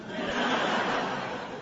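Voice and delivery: a breathy laugh at his own joke; chuckling at the punchline to the audience; soft laugh. Real, not acted. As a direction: let a quiet laugh slip out.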